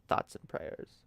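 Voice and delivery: breathy whispered thoughts and prayers; a soft mocking whisper of "Thoughts and prayers". Shown as a demonstration. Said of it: "repeated in a whisper".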